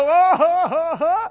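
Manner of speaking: high nervous laugh; imitating a panicky laugh; mock fretful laugh. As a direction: laugh it off anxiously. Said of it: "we go".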